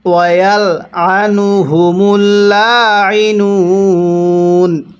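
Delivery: slow, drawn-out tajweed chanting; slow Quran recitation. Shown as a demonstration. Teacher demonstrating recitation to students, slow chant. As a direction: recite each syllable long and measured. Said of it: "কিভাবে পড়ব?".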